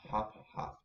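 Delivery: ordinary delivery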